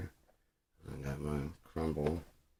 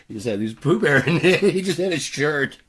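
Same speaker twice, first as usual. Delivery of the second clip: loud, high, talking through laughter; laughing; 10 semitones higher, 13 dB louder than usual. Real, not acted. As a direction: crack up while describing it. Cracking up at his own description.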